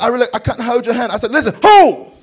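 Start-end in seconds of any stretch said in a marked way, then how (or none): 0.0-1.1 high, loud, fast London wife; mimicked British accent. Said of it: "she talked with this accent just like this". 1.3-2.1 high, loud burst; insistent yell. Retold or imitated. Husband insists over wife's protest.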